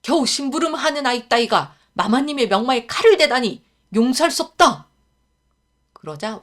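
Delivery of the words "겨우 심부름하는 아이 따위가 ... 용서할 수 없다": higher, loud indignant bodyguard; guard scolding a lowly errand boy; rough angry retort. Acted. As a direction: bark it like an offended guard. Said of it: "거칠게 말을 받았습니다".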